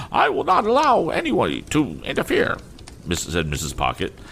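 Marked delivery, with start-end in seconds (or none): 0.1-2.6 very high, imperious scolding lady; haughty mother scolding. A narrator's reading. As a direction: look down on the child and scold her sharply. Mrs Pocket voiced in story.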